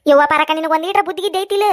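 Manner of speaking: usual speaking voice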